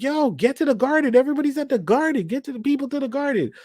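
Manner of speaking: a high, urgent imitated warning cry; hammed-up alarm call; mock warning. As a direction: voice her yelling at everyone to get out. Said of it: "she's trying to warn Magneto".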